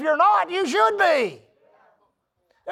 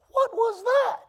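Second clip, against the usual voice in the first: puzzled; 11 semitones higher, slower than usual. Retold or imitated. Retold bewildered thought in meeting.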